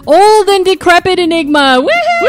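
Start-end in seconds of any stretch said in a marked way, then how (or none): 1.8-2.2 excited cheer. Real, not acted. Cheering during show roll call.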